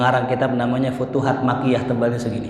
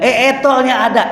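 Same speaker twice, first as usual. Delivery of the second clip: eager offer; 10 semitones higher, 5 dB louder, faster than usual. Retold or imitated. Teacher eagerly offers the oil.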